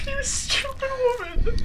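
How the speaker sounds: high shrieking yell. Real, not acted. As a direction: shriek at her.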